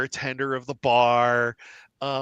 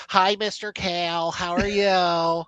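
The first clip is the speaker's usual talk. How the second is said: put-on higher, singsong child voice; little kids; 5 semitones higher than usual. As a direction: greet him like little kids. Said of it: "these little kids".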